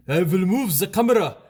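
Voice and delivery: loud, high hammed-up Japanese accent; mock Japanese accent. Mechanic jokes at camera in a loud mock Japanese accent. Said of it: "so I should do a Japanese accent".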